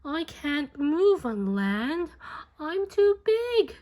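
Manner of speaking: high, loud, despairing whale; stranded whale moaning to the snail; pained moan. A narrator's reading. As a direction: wail that he is stuck. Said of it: "moaned the whale".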